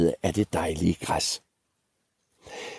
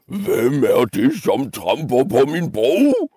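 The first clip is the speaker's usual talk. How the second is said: angrier screaming troll; 5 semitones higher than usual. Acted. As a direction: stretch the challenge out angrily. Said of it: "skreg trolden endnu vredere og argere end før".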